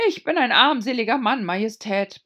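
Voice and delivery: high, loud desperate plea; pleading Hatter. A narrator's reading. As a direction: beg the king on your knee.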